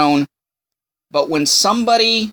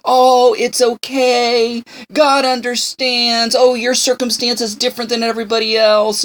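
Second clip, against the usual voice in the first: smooth-talking false friend; 6 semitones higher than usual. Retold or imitated. Imitating a flattering friend brushing off your sin. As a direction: coo it like a flatterer glossing over sin. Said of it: "They will smooth over".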